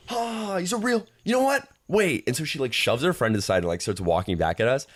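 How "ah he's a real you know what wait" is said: very high, exasperated imitation; mock irate. Woman about to walk back at them, mock irate. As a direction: break off her jeer in mock fury.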